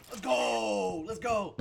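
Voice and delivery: loud whoop, first go drawn out; excited cheering. Real, not acted. Player celebrating a success in game, cheered loudly. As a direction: shout it in triumph.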